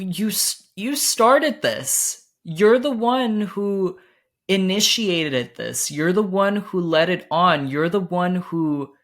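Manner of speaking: high, loud, slow accusation; accusing. Retold or imitated. To the boy who started it, hurt loud accusation. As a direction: jab every you're the one.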